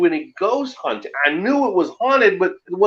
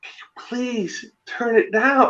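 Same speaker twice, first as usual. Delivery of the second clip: put-on slow, soft, faded old lady plea; drawn-out, gentle asking from an old woman; sweet old lady; 5 semitones higher, 4 dB quieter than usual. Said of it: "She goes".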